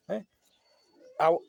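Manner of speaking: ordinary talk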